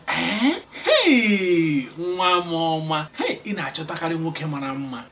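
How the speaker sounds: loud, thrilled mother praising her daughter; mother delighted at her daughter's news. Acted. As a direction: burst out with joy, like a proud mum. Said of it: "your mother will say".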